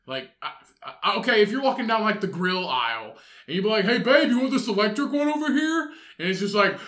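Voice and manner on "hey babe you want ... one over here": higher, louder mock partner voice; loud girlfriend. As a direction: call across the store to your partner. Girlfriend calling out in store.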